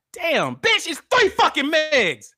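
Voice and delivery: high, loud, drawn-out outburst; real exasperated anger. Real, not acted. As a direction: shout it at the end of your rope. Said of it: "We're all mad".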